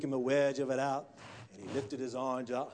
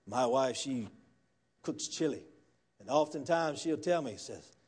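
ordinary talk

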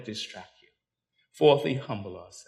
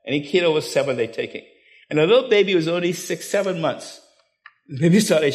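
normal talking voice

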